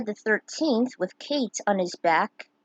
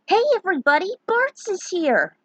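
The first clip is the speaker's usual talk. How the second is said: excited; 10 semitones higher, 5 dB louder than usual. Acted. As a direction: call everyone over, thrilled. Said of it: "Lena said with excitement".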